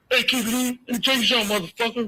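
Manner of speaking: high-pitched imitation of an angry cop; Reggie Senior. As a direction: ham up a cop barking a threat. Retold threat from Reggie Senior.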